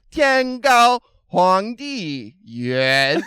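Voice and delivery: very slow, drawn-out tones swooping high; exaggerated tones. Teasing Hunter's first Chinese attempt, hugely drawn-out tones. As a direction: stretch each syllable, singing the tones big. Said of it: "I was like".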